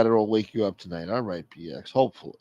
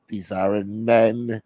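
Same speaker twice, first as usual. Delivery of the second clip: slow, drawn-out words at usual pitch; subdued mock lament; slower than usual. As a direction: say it as a restrained, mournful complaint. Caller repeats the lament, subdued.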